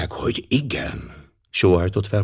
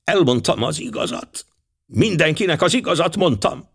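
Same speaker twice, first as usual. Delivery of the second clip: defiant; 8 semitones higher than usual. Acted. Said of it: "A lány szeme dacosan megvillant".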